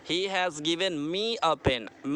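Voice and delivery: slowly again, stressing me; slow repeat. Shown as a demonstration. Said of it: "Me क्या होगा?".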